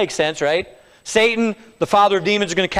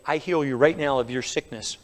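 quiet, offhand healing claim; understated pronouncement; soft demo line; 6 semitones lower, 7 dB quieter than usual. Shown as a demonstration. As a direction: say it low and easy, like anyone could.

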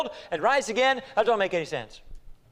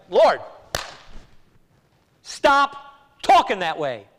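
loud, slow, spaced-out rebuke; acted-out scolding, each part landed hard; harsh rebuke; 2 semitones lower, 5 dB louder, much slower than usual. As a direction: rebuke firmly, dragging it out loudly. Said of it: "I may not have said it harshly".

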